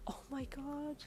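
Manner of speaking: high, hushed shock; soft gasp. A narrator's reading. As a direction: breathe it out softly, stunned. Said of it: "you gasped softly".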